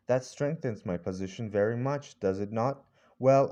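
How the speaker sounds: usual speaking voice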